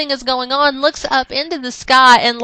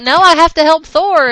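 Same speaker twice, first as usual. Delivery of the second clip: raised, higher heroic protest; Baldur protesting; 6 semitones higher, 7 dB louder than usual. Acted. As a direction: cry out to save Thor. Baldur voiced in retold comic.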